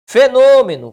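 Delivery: "Fenômeno" high and loud on a held "nô"; stretched tonic syllable. Shown as a demonstration. Teacher sounding out "Fenômeno" for its stress rule, emphatic. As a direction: punch out the "nô" in "Fenômeno". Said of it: "Pró-paroxítona".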